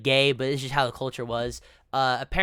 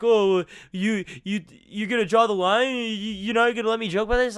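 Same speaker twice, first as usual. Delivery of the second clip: higher whiny protest, stammering; mock impression of streamer; 5 semitones higher, 3 dB louder than usual. Mocking the streamer objecting to criticism, higher stammering imitation. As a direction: mimic him protesting, pitched up. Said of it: "And he's like".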